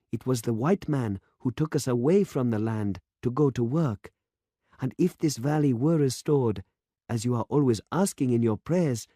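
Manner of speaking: put-on an earnest young patriot arguing with the priest; young demonstrator, passionate. A narrator's reading. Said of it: "said the young man".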